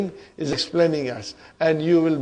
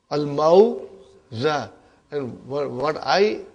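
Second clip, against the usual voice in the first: ordinary talk, nothing marked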